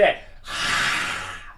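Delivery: "ra" as a rough, voiceless scrape in the throat; throat-scraping R. Shown as a demonstration. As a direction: scratch the back of the throat on "ra". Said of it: "ele ainda coça a garganta".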